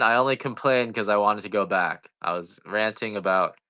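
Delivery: normal talking voice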